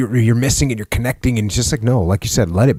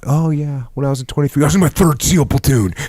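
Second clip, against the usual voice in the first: a touch higher, hammed-up one-upping brag; mock boast; 6 semitones higher than usual. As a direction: play it as a smug jokey comeback. Mocking a young gym bragger.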